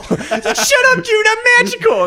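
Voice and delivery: very high, loud silly squeal; defiant mock shout. Short friend brushing off the warning, high defiant shout. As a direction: yell it back in a tiny defiant voice.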